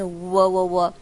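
"喔" as a loud rounded w-o; rounded w sound. Shown as a demonstration. Teacher drills the rounded w start of "wherever". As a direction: purse lips for the w onset. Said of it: "是喔喔喔".

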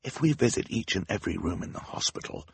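soft whispered aside; whispering. Acted. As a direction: whisper it to your sister. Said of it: "Klaus whispered to his sister".

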